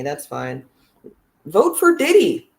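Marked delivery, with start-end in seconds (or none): 1.5-2.5 hyped shout-out. Pretend. Hyped call to vote [name].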